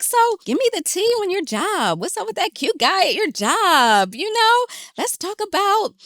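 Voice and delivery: high, lilting, nosy chat voice; eager gossip prompt. Retold or imitated. Steering worried friends toward job gossip, playfully eager.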